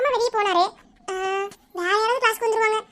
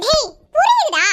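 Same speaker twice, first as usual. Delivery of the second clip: loud, raised-pitch cut-off; exasperated outburst; 5 semitones higher, 7 dB louder, slower than usual. Acted. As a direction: cut him off sharply. Cutting off persistent questioner. (எரிச்சலுடன் சத்தமாக அடக்குதல்)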